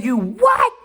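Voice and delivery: high, loud disbelieving "what"; shocked outcry. Retold or imitated. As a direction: yell it in total shock. Shocked at his age.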